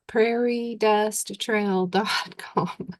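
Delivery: slowed down, every word separate; slow and clear. Shown as a demonstration. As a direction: spell it out slowly and clearly. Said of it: "roll the words together somehow in Okie talk".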